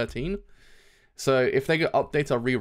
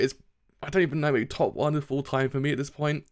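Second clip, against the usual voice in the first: ordinary talk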